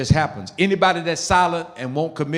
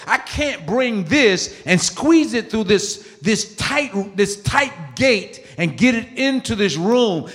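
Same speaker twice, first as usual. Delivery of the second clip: slow, higher, squeezed-out words; strained slow; 7 semitones higher, slower than usual. Acted. Preacher miming forcing baggage through a tight gate, slow. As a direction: drag it like pushing through a narrow gate.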